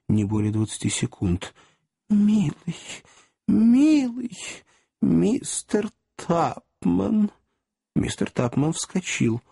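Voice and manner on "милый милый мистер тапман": tender. Acted. Aunt's tender outburst.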